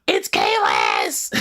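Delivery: drawn-out mock fan shout; hammed-up, stretched yell of recognition; freaking-out fan. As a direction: shout the name in starstruck disbelief. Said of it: "That's what I feel like".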